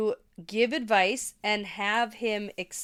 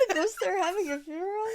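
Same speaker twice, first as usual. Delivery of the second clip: laughing disbelief; 9 semitones higher, 3 dB quieter, faster than usual. Real, not acted. Co-host questioning a ghost funeral, laughing in disbelief.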